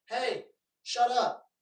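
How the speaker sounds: mock scolding. Yelling at barking dog.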